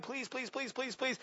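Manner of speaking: high, hushed desperate pleading; soft begging. Retold or imitated. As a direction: beg under your breath. Saul begging Samuel to stay.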